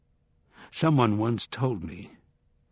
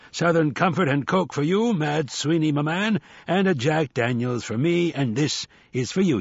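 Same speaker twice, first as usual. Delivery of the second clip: higher-pitched, expansive host handing out drinks; hearty older hustler; 5 semitones higher, 3 dB louder than usual. Acted. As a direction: play the jovial hustler serving a round.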